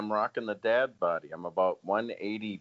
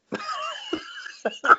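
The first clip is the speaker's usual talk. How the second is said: high shrieking laugh. Real, not acted. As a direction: burst out laughing. Reacting to crude joke.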